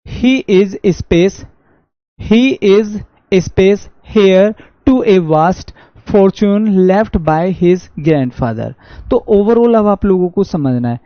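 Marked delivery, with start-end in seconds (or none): 0.1-8.7 reading the quiz sentence out slowly, word by word; measured dictation pace; slow reading aloud. Shown as a demonstration. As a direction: spell out each word of the question.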